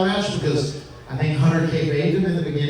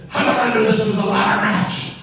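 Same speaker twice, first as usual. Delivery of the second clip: angry Vince; 6 semitones higher than usual. Retold or imitated. Vince yelling at Matt on the phone, high and angry. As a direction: rant down the phone like an irate boss. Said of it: "He said".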